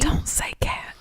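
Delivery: unvoiced urgent whisper. Real, not acted. Listener whispering a warning not to mention the cat. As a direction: hiss a warning under your breath.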